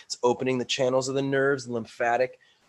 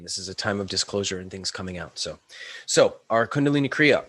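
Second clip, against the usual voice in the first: ordinary talk, nothing marked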